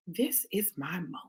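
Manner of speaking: very soft, low gloating inner voice; hushed scheming. Acted. Woman plotting revenge on her ex in her head, hushed gloating. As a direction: breathe it like a secret plan.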